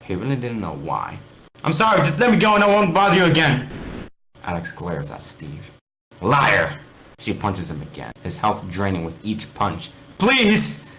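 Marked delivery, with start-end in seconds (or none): 1.6-3.7 high, loud begging; desperate plea. Acted. Steve begging again. 6.2-6.8 loud snapped accusation; angry accusation. Acted. Alex glares at Steve. 10.2-10.9 high, loud desperate please; pleading. Acted. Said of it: "He pleads one more time".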